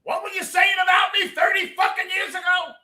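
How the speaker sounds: incredulous scream; mock outraged shout. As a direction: shout it like an angry ghost confronting him. Imagined confrontation shouted.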